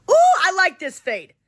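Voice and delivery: light up at the haircut picture; excited. Real, not acted. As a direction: streamer thrilled by a fade haircut photo, high and loud.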